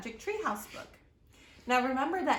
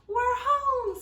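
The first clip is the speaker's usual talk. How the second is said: much higher and louder joyful cry; excited; 14 semitones higher, 10 dB louder than usual. Acted. Annie arriving home in the treehouse, joyful cry. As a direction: burst out with relief at being home. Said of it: "said Annie".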